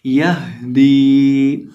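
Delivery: यह दी with a breathy हा from the throat, very slow. Shown as a demonstration. Teacher demonstrates the हा sound in यह दी. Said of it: "इसकी आवाज़ आनी चाहिए यह दी".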